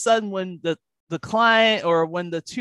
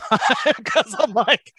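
usual speaking voice

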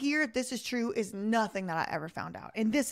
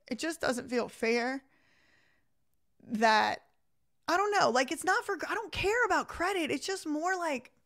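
fighting back tears, words breaking off; getting emotional; 5 semitones higher than usual. Real, not acted. Thanking viewers while reliving her ordeal, emotional and higher. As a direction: voice lifting higher as emotion rises. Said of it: "it just makes me emotional".

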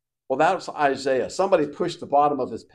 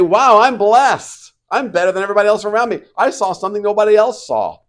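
loud, high, smug bragging; hammed-up boast of being better; mock proud boast; 7 semitones higher, 7 dB louder, faster than usual. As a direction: play the arrogant show-off, half mocking. Mock proud Isaiah quote.

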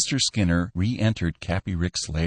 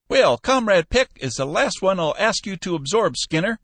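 put-on high, louder old man pleading and scolding; scolding old Cappy; 13 semitones higher, 4 dB louder than usual. Old boss scolding his manager, high and loud. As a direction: rib him like a cranky old boss.

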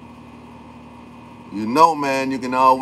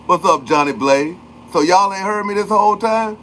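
pitched-up, eager shout-outs; higher excited greeting; 8 semitones higher than usual. Real, not acted. Streamer re-greeting chat after connection loss, eager. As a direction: call the names out again, bright and eager.

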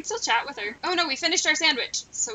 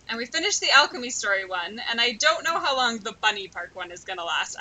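normal talking voice

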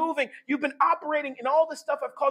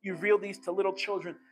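lower, softer prayer; prayerful Jesus; 6 semitones lower, 4 dB quieter than usual. Acted. Jesus thanking the Father, a soft reverent prayer. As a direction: pray it reverently.

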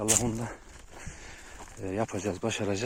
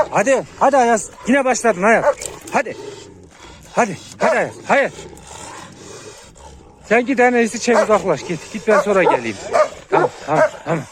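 loud, high exasperated yelling; real frustrated yelling; frustrated shout; 9 semitones higher, 8 dB louder than usual. Real, not acted. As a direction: shout over the dogs to separate them. Dogs fighting again, shouting.

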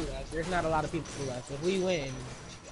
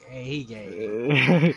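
slow, stretched teasing drawl; drawn-out teasing; 4 semitones lower, 4 dB louder, much slower than usual. Pretend. Catchphrase repeated, stretched out.

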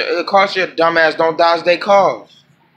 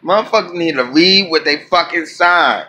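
usual speaking voice

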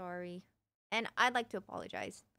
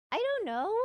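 squeaky dodge; 10 semitones higher than usual. Real, not acted. Awkward dodge of viewer question.

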